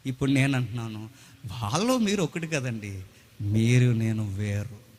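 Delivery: ordinary talk, nothing marked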